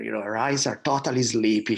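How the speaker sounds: usual speaking voice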